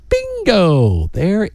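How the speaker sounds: long, drawn-out high BINGO, sliding down; slow, gleeful cry of discovery; triumphant exclamation. Real, not acted. Radio host reaching the moment the ring turns up, triumphant.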